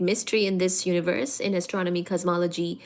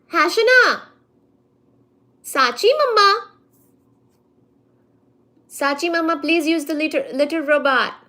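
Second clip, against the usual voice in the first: higher, louder cooing at the cat; 7 semitones higher, 5 dB louder than usual. Real, not acted. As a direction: call the cat like a doting mother. Talking to her cat.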